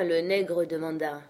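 ordinary delivery

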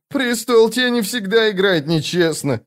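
high, plaintive twin brother; whining. A narrator's reading. Twin brother complaining to Paran about unfair play, high whine. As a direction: whine it, pitched well above the narration. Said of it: "заскулил брат".

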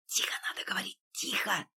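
hissed urgent whisper. A narrator's reading. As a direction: hush them in a sharp whisper. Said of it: "зашипел Фатьянов".

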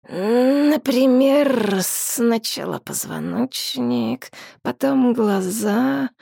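slow, lazy, venomous dragon voice; lazy drawl. A narrator's reading. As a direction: savour the cruelty slowly. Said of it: "лениво проговорил дракон".

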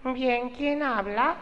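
very high, slow, haughty falsetto; wife to her compadre on the phone, high slow snooty voice. Retold or imitated. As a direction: drag out a stuck-up lady voice.

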